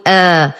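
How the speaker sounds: آ held as a long "aa"; long vowel demo. Shown as a demonstration. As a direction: hamza followed by a drawn-out vowel in آ. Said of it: "فهو مد بدل". (آ بمد البدل)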